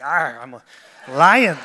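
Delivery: mock lion growl, then a loud burst; playful lion. Preacher joking about strutting past with his mane, playful lion. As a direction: growl playfully and punch out the animal's name. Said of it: "strut past and be like".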